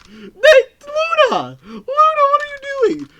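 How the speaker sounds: very high, loud yelling call; shout. Real, not acted. Streamer yelling at Luna in chat, loud high shout. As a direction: cry out in high loud shock.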